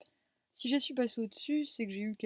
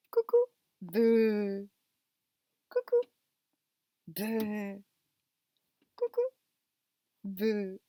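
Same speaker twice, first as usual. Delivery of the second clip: loud, bouncy peekaboo voice for a pet; playful baby talk; 7 dB louder, faster than usual. Acted. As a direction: tease the cat into playing. Playing peekaboo with the cat.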